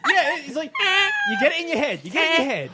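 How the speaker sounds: high put-on voice. Trying a high voice for the crying bit.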